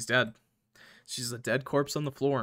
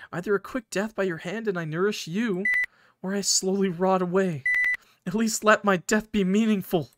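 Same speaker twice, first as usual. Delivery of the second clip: a higher, frightened plea that builds; pleading mushroom girl; 5 semitones higher than usual. Acted. Button begging her friend to end her pain, higher, desperate plea. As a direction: beg to be let go, voice lifted and strained.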